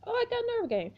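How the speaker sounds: very high excited cry; excited squeal. Real, not acted. Excited reaction.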